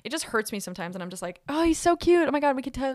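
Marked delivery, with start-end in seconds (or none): 1.5-2.9 higher, louder swooning gush. Retold or imitated. Imitating herself swooning over a profile, higher gushing. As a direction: swoon over a cute guy.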